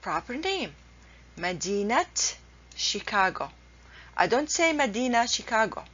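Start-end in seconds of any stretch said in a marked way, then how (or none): 1.4-3.5 مدينة said "madinat", t sounded, then شيكاغو; correct linked pronunciation. Shown as a demonstration. Teacher models a proper name, مدينة pronounced "madinat". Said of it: "is the right way to pronounce it". 4.7-5.8 مدينة said "madina", quickly into شيكاغو; wrong pronunciation, t dropped. Shown as a demonstration. Teacher says what not to say, مدينة pronounced "madina". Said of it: "this is a wrong way to pronounce it".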